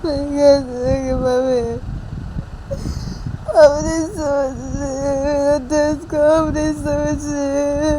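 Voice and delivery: long wailing lament, words dragged out; acted crying. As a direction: wail that nobody understands your pain. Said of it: "रोना बंद करो". (बिलख-बिलख कर रोने का अभिनय)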